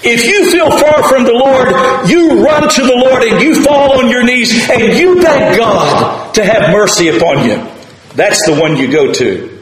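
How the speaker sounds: high, loud exhortation; fiery sermon climax; preaching at full force. Acted. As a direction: drive the point home to the pews. Exhorting the congregation.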